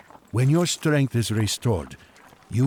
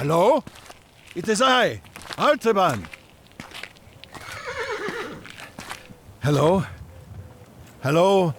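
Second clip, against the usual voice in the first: calling out; 10 semitones higher than usual. Acted. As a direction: call out to someone far ahead. Calling out to a distant caravan.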